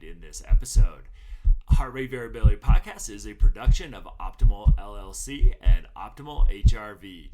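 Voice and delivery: low, measured podcast announcer read; announcer intro read. Acted. Host opening the podcast for listeners, smooth announcer read.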